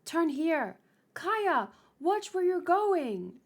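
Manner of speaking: high-pitched, urgent calling out; urgent warning. Acted. Warning Kaya as they flee, calling out urgently. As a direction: call a warning to someone running.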